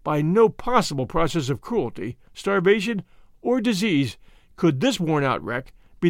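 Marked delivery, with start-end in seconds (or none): none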